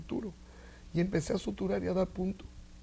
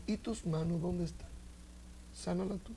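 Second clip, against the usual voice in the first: hushed inner voice of God; quiet voice of God; 7 dB quieter than usual. Retold or imitated. Voice of God speaking to him.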